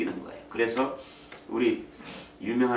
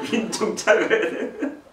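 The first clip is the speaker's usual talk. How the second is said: higher, slow, through laughter; laughing retelling; 11 semitones higher, 8 dB louder than usual. Retold or imitated. Preacher repeating the first grader's words, laughing. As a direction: laugh at the tiny kid's big word. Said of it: "일 학년 짜 아이가".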